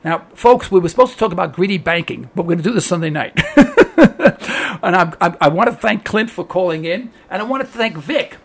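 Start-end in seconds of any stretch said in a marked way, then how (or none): 3.4-4.8 a quick high burst of laughter; short laugh. Real, not acted. Host laughing to his listeners, a quick high laugh. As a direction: laugh it off at the delay to Sunday.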